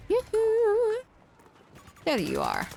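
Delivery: high, loud teasing call; sing-song call. Pretend. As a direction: call out yoo-hoo playfully. Calling out to enemy.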